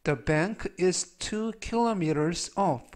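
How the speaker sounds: very slow, careful English reading; slow, clear teacher's demo of an English sentence; slow model sentence. Shown as a demonstration. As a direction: spell out each word for the learners. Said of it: "라는 뜻이 되겠습니다".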